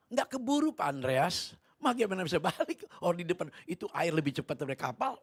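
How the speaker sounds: high, loud objection; urgent protest. Retold or imitated. Captain tells preacher turning is impossible, loud urgent protest. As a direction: argue back loudly in alarm.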